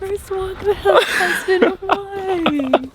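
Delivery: very slow, laughing out each word; laughing through it. Real, not acted. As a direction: giggle your way through the line. Wife repeats husband's cheesy line.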